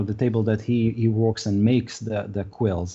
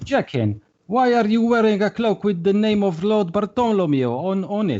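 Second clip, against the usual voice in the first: pitched higher, suspicious question; suspicious shopkeeper; 9 semitones higher than usual. Acted. Mister Jolly questions a player about his stolen-looking cloak, higher and suspicious. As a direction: probe him, higher and pointed.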